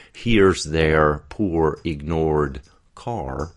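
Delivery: slow, drawn-out R in "Here's their poor, ignored car"; strong R quality. Shown as a demonstration. Coach showing the accent's tongue tension, heavy R on "ignored car". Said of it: "with a lot of er qu-, quality to it".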